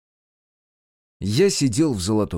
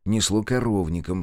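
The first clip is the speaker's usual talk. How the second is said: plain reading voice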